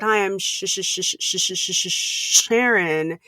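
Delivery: stuck repeating Sh- before Sharon; stammering on the name; stuttering. Real, not acted.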